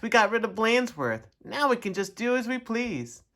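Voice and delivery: high, gleeful pupils; lightly acted class celebrating freedom; excited cry. A narrator's reading. Class free of the principal, excited. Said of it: "cried the class".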